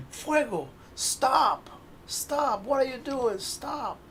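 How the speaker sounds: high-pitched frantic pleading with his fire pet; panicked magician. Acted. Magician begging his fire creature to stop, high and frantic. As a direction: cry out high to stop the runaway flame. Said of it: "the magician saying".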